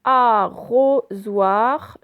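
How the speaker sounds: "Arrosoir" very slow and loud, in separate syllables. Shown as a demonstration. Teacher showing O in middle syllable, syllable by syllable. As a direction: break "Arrosoir" up so "ro" stands out. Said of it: "je l'ai prononcé dans ro".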